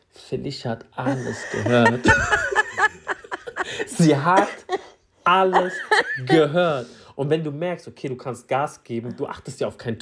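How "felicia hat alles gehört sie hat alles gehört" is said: breaking into laughter on the punchline; lachend. Real, not acted. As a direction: laugh through it, word by word. Cracking up at the punchline. (vor Lachen stockend)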